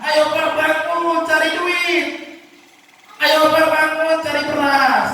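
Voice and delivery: high, slow mock nagging; nagging wife. Wife nagging husband to earn money, high and slow. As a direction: drag it out like a shrill nag.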